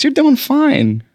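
high and slow, stretched out; sing-song it slowly, mock soothing; drawn-out high reassurance. Teasing a guest, repeated.

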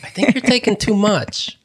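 high, retold gentle objection; cautioning mom. Daughter cautions mom sampling others' food, high-pitched.

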